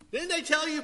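put-on high, exasperated parent scolding a kid; mock a fed-up parent nagging their teen.

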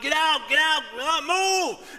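a frustrated driver shouting at the cars ahead; impatient yelling. Retold or imitated. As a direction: yell at the traffic to clear off. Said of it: "he starts yelling out".

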